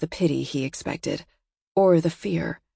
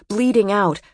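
ordinary delivery, nothing marked

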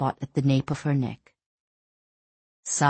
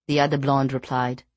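plain reading voice

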